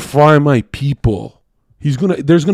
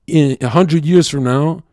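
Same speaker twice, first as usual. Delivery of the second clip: ordinary delivery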